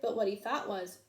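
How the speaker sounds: ordinary delivery, nothing marked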